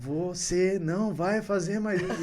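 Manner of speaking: mock stern aunt spelling out every beat; syllable by syllable. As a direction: scold like a mother spanking on every syllable. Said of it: "ele apanhava em sílaba".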